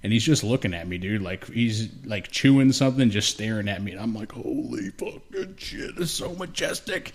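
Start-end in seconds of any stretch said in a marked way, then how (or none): 4.3-7.1 slow, soft, rising to a high amazed pitch; slow hushed awe. Retold or imitated. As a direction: drag it out, stunned by the deer. Awed by deer staring nearby.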